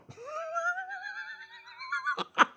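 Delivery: long, high laughing sound; drawn-out laugh. Real, not acted. Player at his own global operations boat, long high laugh. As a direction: let out a prolonged high laugh. Reacts to silly ship design.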